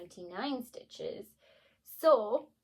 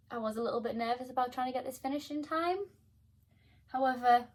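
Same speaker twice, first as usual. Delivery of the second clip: ordinary talk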